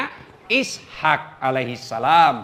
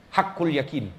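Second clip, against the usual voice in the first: usual speaking voice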